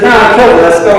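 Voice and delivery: there, done, let's move; impatient. Retold or imitated.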